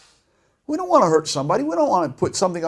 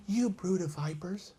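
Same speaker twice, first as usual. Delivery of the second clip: much softer, slow and timid rebuke; meek, soft-spoken; 13 dB quieter than usual. Shown as a demonstration. Preacher showing how the prophet didn't say it, meekly soft. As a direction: say it gently, with no passion at all. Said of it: "He didn't say".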